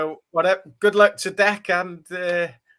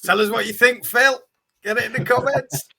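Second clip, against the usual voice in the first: calling out; 5 semitones higher, 4 dB louder than usual. Real, not acted. Calling out to a friend.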